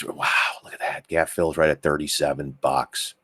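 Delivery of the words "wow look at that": breathless whispered wow; awed whisper. Real, not acted. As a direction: hush it out in amazement. Amazed at the chart gap.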